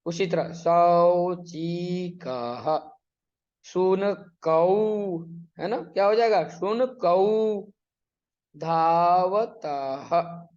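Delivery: slow, emphatic building of each word; drawn-out syllables. Shown as a demonstration. Teacher building words from letters, very slow. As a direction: stretch "शुनकौ" very slowly, syllable by syllable. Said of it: "वर्ण को मिला के पद बनाया".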